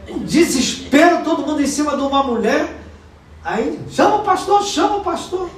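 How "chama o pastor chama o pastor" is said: high, frantic "Chama o pastor"; mock panicked shout for the pastor; panicked call. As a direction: retell the crowd's alarm, high and urgent. Retold panic around a woman.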